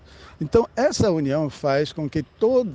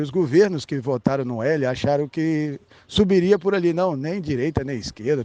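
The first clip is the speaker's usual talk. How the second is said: ordinary talk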